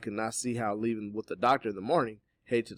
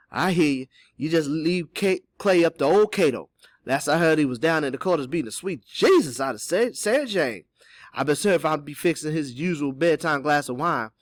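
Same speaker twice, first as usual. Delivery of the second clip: loud, higher dialect man's voice; confident character reply, raised in pitch; assured Cato; 7 semitones higher, 10 dB louder than usual. Acted. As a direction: take charge in a hearty dialect voice. Voices Cato taking on the task.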